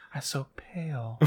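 hushed, slow mock whisper. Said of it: "She's like".

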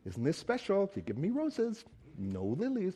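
hushed mock church lady voice. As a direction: sniff it out like a prim church lady. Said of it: "he's really not the church lady".

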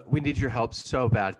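ordinary delivery

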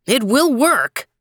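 slow, loud, high call. A narrator's reading. First mandarin calling out in excitement, slow loud call. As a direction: call it out across the court. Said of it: "called out the first mandarin".

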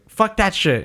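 put-on high kid voice swearing; high-pitched mock toddler; child cursing. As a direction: imitate a little kid refusing. Kid cursing in blooper retold.

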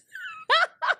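very high, loud cackle; shrieking laugh. Real, not acted. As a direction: burst out in a squealing laugh. Embarrassed at own video.

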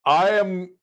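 put-on slow, high, formal old-fashioned voice; archaic noble speech. Excusing himself to Orkira, slow formal high voice. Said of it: "I say".

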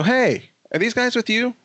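high-pitched call down the line. Retold or imitated. Asking everybody in line who they're with, calling out.

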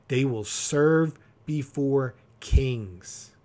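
slow, every word of the proverb weighed; slow emphatic reading. Shown as a demonstration. Pastor reading Proverbs to his congregation, slow and emphatic. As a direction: let each word land slowly. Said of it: "reading the proverb with weight".

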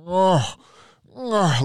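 high, loud imitated bull moose grunt, drawn out. Telling friends about the moose outside his tent, loud grunt imitation. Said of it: "loud as hell".

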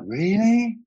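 high, loud startled "really"; mock surprise. Retelling his kids' talk, a loud surprised squeak. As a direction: act shocked, pitch shooting way up. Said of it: "And I'm like".

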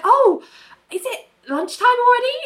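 very high, fast, hammed-up innocence; mock feigned surprise. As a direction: rush out a flustered, overdone innocent line. Ali feigning surprise, flustered.

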